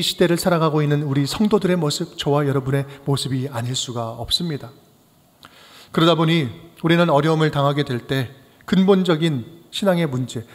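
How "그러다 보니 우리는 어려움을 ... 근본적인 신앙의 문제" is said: ordinary talk, nothing marked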